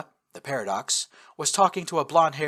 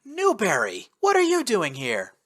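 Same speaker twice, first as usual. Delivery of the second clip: surprised; 8 semitones higher than usual. A narrator's reading. Surprised reunion with old buddy.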